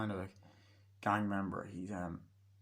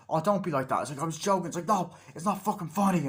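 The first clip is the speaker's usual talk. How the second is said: high, loud back-and-forth protest; heated mock indignation; acted quarrel; 7 semitones higher, 5 dB louder than usual. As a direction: argue it out in a raised voice. Retold quarrel.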